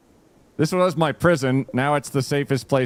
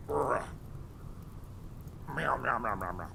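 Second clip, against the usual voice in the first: meowing at cat; 12 dB quieter than usual. Pretend.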